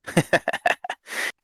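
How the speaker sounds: low-key breathy chuckle; conspiratorial chuckle. Acted. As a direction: snicker along with a drinking buddy. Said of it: "chuckle and".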